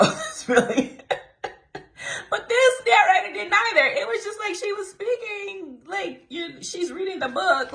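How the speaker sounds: laughing high through the words; high giggly laughter. Real, not acted. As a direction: crack up while explaining. Laughing at narrator impression.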